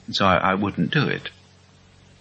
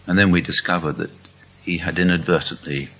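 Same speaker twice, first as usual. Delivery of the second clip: ordinary talk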